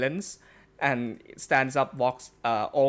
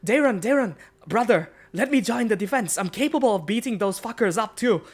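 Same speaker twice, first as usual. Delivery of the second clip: put-on higher, pleading young wolf boy; eager young voice; 8 semitones higher, 3 dB louder than usual. Young wolf boy begs Darren to join the defense. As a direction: beg your brother to let you fight. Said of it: "wolf boy now stands back up again and says".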